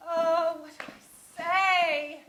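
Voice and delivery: loud, slow, drawn-out lament; anguished, stretched-out plea; despairing wail. Acted. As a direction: wail it at a complete loss. Despairs over what to say.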